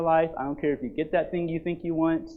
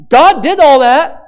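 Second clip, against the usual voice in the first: loud, higher, slow proclamation; emphatic declaration; 12 semitones higher, 17 dB louder, slower than usual. Acted. Pastor proclaiming to the congregation, loud slow declaration. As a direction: hammer it home. Climax of preaching.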